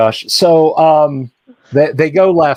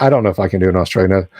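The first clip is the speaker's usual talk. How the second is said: ordinary talk, nothing marked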